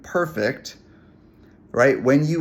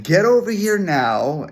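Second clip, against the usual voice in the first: raised, higher, slower stern order; angry command; 7 semitones higher, 4 dB louder than usual. Retold or imitated. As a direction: snap at the dog to come now. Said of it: "starting to get a little bit louder".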